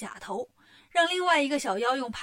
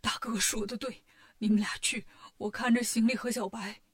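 subdued, mildly tearful voice; 3 semitones lower, 3 dB quieter than usual. A narrator's reading. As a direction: speak softly through mild tears. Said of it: "沙僧哭着说".

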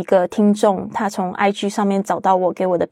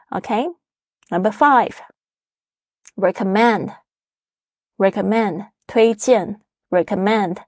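recommend 的重音落在 mend 上; stress on mend; 2 semitones higher, much slower than usual. Shown as a demonstration. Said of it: "重音是在recommend，是在mend上面". (重音放在 mend 音节)

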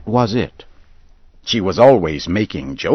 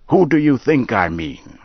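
higher, a bit louder testy retort; lightly voiced Henry impatient with Dodge; irritated old man; 7 semitones higher, 4 dB louder than usual. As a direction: snap back, offended.

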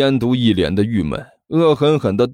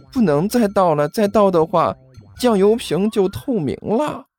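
put-on high, eager young man; a timid apprentice asking; 7 semitones higher than usual.

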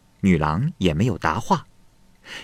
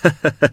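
short laugh; 8 semitones higher, 3 dB louder than usual. A narrator's reading. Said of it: "华姑出来和巧娘说道".

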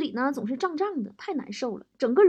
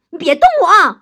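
loud, high wifely outburst; very angry; 6 semitones higher, 14 dB louder than usual. Acted. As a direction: act out a wife snapping at her husband. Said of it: "王嫂特别生气". (王嫂生气地高声呵斥)

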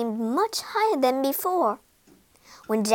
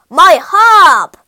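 huge bellow, raised in pitch; giant's cry; 5 semitones higher, 17 dB louder than usual. A narrator's reading. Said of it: "he cried".

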